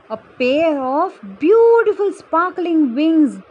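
a slow, awed storyteller reveal; wonder reveal. A narrator's reading. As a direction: unveil it like a magic gift.

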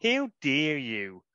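slow, drawn-out New Zealand "How dare you?"; indignant New Zealand accent, taken slowly. Shown as a demonstration. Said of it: "I can convincingly say in a New Zealand accent".

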